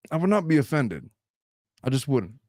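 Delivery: ordinary talk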